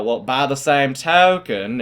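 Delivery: slow, twangy imitation of an old math teacher; teacher's twang impression. Student quoting his teacher's pet phrase, twangy impression. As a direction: stretch the phrase in a drawn-out twang. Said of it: "you get how he talks".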